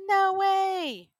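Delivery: high, bright burst of disbelief; surprised disbelief. Real, not acted. Host amazed by the guest's follower numbers, surprised. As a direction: gasp it out like you can't believe it.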